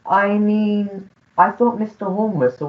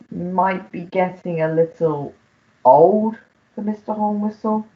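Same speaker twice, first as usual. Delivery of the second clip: ordinary delivery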